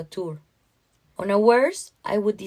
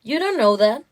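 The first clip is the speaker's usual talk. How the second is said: higher, louder objection; protesting retort; 4 semitones higher, 5 dB louder than usual. Acted. Mother contradicting her husband about their daughter, protesting. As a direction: push back at him, voice lifted. Narrator's retort in acted argument.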